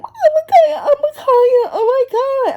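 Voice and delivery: high whining wail with a laugh; whining kid. Retold or imitated. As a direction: wail like an upset nephew. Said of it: "why are you whining".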